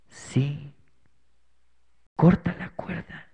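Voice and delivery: slow, breathless, voiceless whisper from afar; ghostly whisper. Acted. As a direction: whisper it slowly like a voice out of nowhere. The voice answers again. (susurro lento y misterioso)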